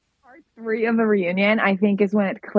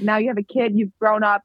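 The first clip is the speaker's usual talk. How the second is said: usual speaking voice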